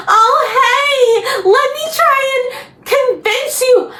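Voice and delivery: high, put-on chirpy persuasion; mocking Jeremiah. Vlogger imitating Jeremiah offering to convince her, high mocking voice.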